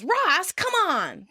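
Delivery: high-pitched retold protest; exasperated mock yell, not full volume. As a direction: yelp it in mock annoyance. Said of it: "Rachel flinches and yells".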